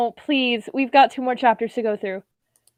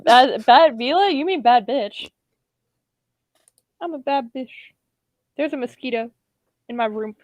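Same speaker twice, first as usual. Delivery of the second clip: plain reading voice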